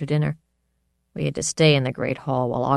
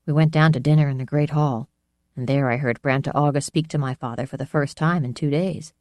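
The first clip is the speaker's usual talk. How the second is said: plain reading voice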